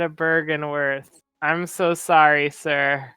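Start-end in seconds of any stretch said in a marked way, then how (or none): none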